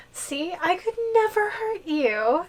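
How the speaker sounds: sweet reassurance. Acted. Giantess reassures captive sweetly.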